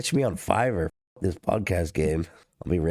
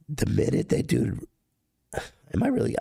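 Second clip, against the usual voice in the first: usual speaking voice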